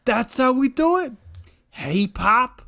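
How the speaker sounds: put-on high, chirpy young shark voice; Lenny's voice. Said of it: "And he goes".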